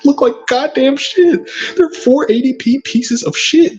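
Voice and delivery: high, laughing ridicule; mock him through a laugh; laughing mockery. Mocking rival's videos.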